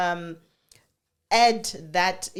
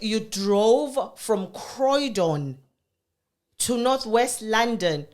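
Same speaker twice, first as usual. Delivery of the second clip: shocked disbelief; 3 semitones higher, 4 dB louder, much slower than usual. Retold or imitated. Mother shocked at son's drive.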